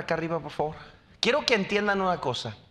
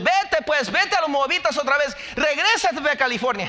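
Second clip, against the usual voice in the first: higher, louder shooing voice; mock Naomi shooing Ruth; 8 semitones higher, 4 dB louder than usual. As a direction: push her away, half joking. Naomi sending Ruth away.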